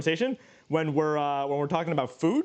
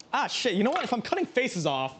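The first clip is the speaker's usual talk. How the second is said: ordinary talk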